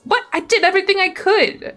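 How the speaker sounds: high-pitched outburst of protest; indignant protest. Real, not acted. Streamer objecting to the game's verdict, indignant protest. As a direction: burst out defensively, pitch jumping up.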